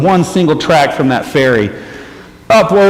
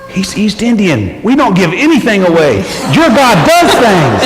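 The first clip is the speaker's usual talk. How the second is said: amazed driver; 7 semitones higher than usual. Retold or imitated. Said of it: "my driver looks at me and he goes".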